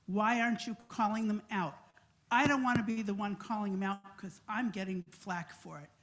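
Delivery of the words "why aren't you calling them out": ordinary talk, nothing marked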